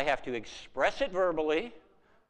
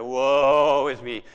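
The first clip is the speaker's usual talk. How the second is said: very slow, lower, hammed-up moaning; mock gloomy lament; 5 semitones lower, 7 dB louder, much slower than usual. Cartoon character always complaining, mock doleful drawl. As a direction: drag it out like a sad sack under a cloud. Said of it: "that was his favorite".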